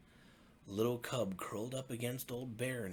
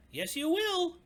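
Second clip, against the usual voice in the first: very high, loud cub insisting; insistent little cub; 10 dB louder than usual. Acted. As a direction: stamp your foot like a bossy cub.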